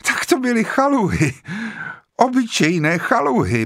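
vysoko, hystericky roztřeseně; hysterické blekotání. A narrator's reading. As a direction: blekotej přes hysterický smích. (Vera hystericky blekotá o chaluhách)

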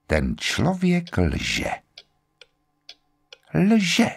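slow, separated words with long pauses; inner conviction. A narrator's reading. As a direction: think it out slowly, word by word. Armstrongova tichá úvaha o lži. (pomalu, přesvědčeně, jako pro sebe)

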